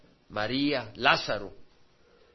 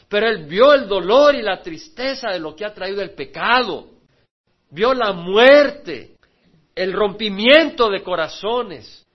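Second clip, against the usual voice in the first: loud, impassioned sermon delivery; full-force preaching; 5 semitones higher, 8 dB louder than usual. Acted. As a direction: pour it out over the listeners. Predicación a plena voz. (predicación apasionada a toda voz)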